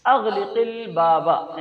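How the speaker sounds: stretched out word by word; very slow model phrase. Shown as a demonstration. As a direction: lay it out slowly for the class. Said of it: "کیسے بولیں گے".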